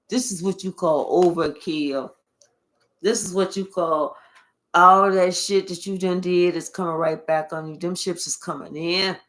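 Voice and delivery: ordinary delivery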